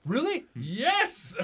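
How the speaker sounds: a high, loud "Really?" and a shouted "Yes"; mock surprise. Acting out a stranger surprised at his height, mock amazement. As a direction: play up fake amazement.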